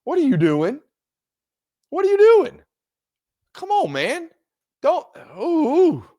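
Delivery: incredulous high yelp. Pretend. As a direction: squeal in mock horror at fans rushing the field. Mocking fans storming field.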